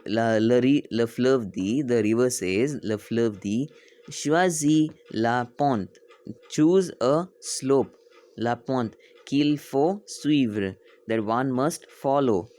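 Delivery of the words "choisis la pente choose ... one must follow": slow, raised, word-by-word reading; slow read-aloud. Shown as a demonstration. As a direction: space out each word for learners. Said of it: "why I'm reading slow".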